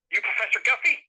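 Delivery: put-on Southern accent. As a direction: lightly mimic a Southern good old boy. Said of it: "like, heavy Southern accent".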